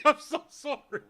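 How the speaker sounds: sorry, said while laughing; laughing apology. Retold or imitated. Apologizing to the owner while laughing. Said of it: "I'm like".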